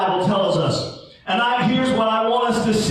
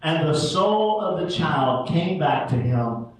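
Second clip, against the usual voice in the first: scripture reading; 8 semitones lower, 3 dB quieter than usual. Acted. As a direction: read the Bible passage to the congregation. Opening scripture reading, measured.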